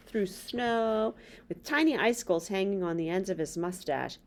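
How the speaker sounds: ordinary delivery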